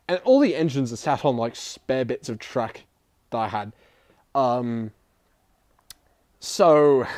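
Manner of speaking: ordinary delivery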